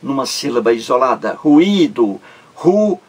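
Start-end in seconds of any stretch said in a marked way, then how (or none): 1.3-3.0 drawn-out stressed middle syllable in "Ruído". Shown as a demonstration. Teacher showing students the word's syllables, stretched stress on í in "Ruído". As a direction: break "Ruído" into syllables, holding the í.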